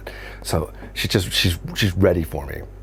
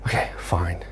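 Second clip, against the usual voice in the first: soft unvoiced okay fine; whispered resignation; 3 semitones lower, 6 dB quieter than usual. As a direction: give in under your breath. Giving in to Bird's plea.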